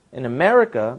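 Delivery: normal talking voice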